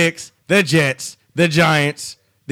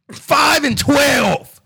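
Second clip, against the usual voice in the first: exasperated; 6 semitones higher, much slower than usual. Real, not acted. Exasperated at record.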